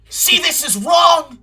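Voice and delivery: loud, high, outraged shout; outraged yelling. Acted. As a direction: yell it in disbelief. Outraged reading demo.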